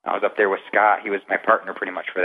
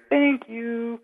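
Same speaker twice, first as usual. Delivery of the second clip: "Thank you." pitched much higher and far off; ghostly woman imitation. Replaying the EVP for podcast hosts, high ghostly imitation. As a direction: mimic the faint ghost voice from the recording. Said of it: "Clear as day, crystal clear".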